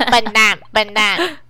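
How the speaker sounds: loud squawky parrot voice; squawking bird voice. Pretend. Bird voice insult.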